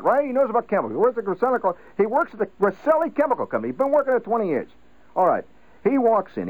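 father recounting his tavern tale to the wife; father telling a story. Retold or imitated.

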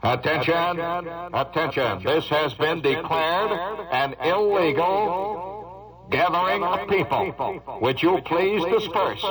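slow, measured police announcement voice; the voice he would love to hear at a party; official dispersal order. Retold or imitated. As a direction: read the order slowly and politely. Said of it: "where I hear that voice".

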